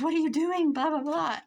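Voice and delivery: high, loud imitation of a frantic friend; retold freaking out. Daughter-in-law running out after the fall, mock freaking out. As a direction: mimic someone panicking, pitch and volume up. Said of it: "She was just freaking out".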